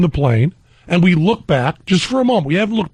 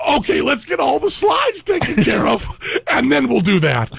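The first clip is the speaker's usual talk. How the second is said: imitating the attendant, pitched up and laughing; flight attendant; 8 semitones higher than usual. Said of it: "And he goes".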